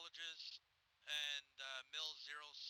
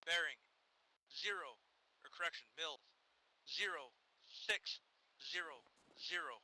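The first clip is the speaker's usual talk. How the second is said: deliberate radio call, word by word; measured dictation with clear gaps; 4 dB louder than usual. Real, not acted. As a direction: give each number its own falling beat.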